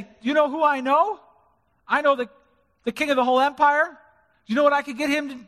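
mock-tough braggart; boastful challenge. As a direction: brag like a threatening bully. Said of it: "He didn't say that".

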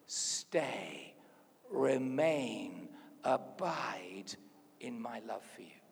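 low, spaced-out single words, softening at the end; gentle, lingering plea to stay; tender slow invitation. Acted. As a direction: let each word hang before the next. Key words of Jesus, slowly intoned.

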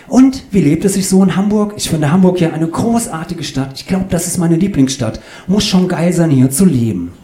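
high, loud, oblivious enthusiasm; gushing chatterbox. Acted. Claudius gushes about Hamburg to the couple, high and excited. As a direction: gush on in a high eager voice.

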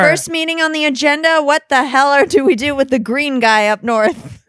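loud town-hall agenda call; mock meeting chair. Acting out Who town meeting.

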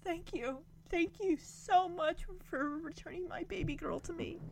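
soft, high, heartfelt thanks in imitation; retold tearful gratitude. Voicing the mom thanking the family, retold tearful gratitude. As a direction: imitate a moved mother, hushed and high.

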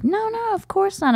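high, innocent reassurance; squeaky denial from the dad; mock dad voice.

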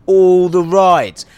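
"All the rides" slow, loud, ALL punched; stress on ALL. Real, not acted. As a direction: drag out "All the rides" and hit ALL. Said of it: "I can go on all the rides".

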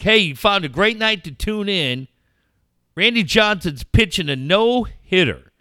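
oversell it like a cheesy broadcaster; mock announcer. Co-host imitating Dave calling a no-hitter, as a hammed-up announcer. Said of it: "Ding-a-ling Dave over here is like".

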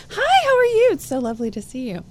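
high-pitched, hammed-up hostess hello; gushing society greeting. Party guest greets someone after a whispered prompt, gushing. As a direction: put on a sugary high greeting voice.

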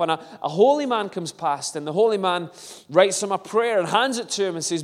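ordinary delivery

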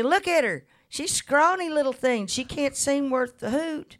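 lightly mock the scrawny kid to the crowd; imitating Mama on tape. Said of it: "this tape of Mama in nineteen fifty-nine say".

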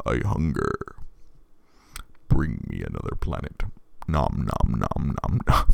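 a giant purple god growling for planets; hungry planet eater. Retold or imitated. Giant purple man who eats planets, booming hungrily. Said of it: "This giant purple man who needs to eat planets".

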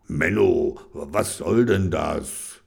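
low, slow grumble; grumbling. Acted. Reggie grumbles at Kenny in the night, low. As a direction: grumble half asleep. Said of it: "stupste Kenny an und brummelte".

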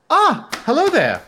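put-on German accent. Receptionist greets an approaching hotel guest, German accent. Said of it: "some variation of my shitty German accent".

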